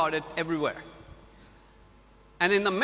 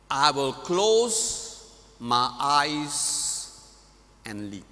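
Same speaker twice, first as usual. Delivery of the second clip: slower solemn reading; slower than usual. Shown as a demonstration. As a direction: stretch every phrase and let it land. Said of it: "Read to you again".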